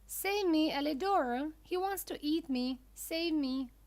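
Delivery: high-pitched cry for help; acted plea, pitched well up; urgent plea. A narrator's reading. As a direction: call out desperately to a rescuer. Puppet's plea for rescue.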